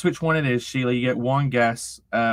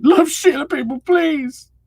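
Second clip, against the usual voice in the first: much higher and louder, laughing through it; high laughing repeat; 14 semitones higher, 7 dB louder than usual. Shown as a demonstration. Streamer echoing chat's plea for Sheila, laughing high and loud. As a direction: repeat it squealing with laughter, stretching please slowly. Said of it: "reads it again".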